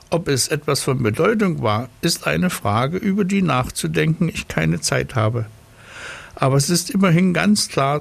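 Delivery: high, fast, lecturing snail. Acted. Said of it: "sagte sie".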